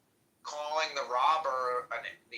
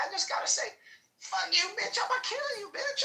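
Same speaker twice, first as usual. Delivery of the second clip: much higher cartoonish voice of the worker; mock excuse voice. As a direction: play him as a silly caricature.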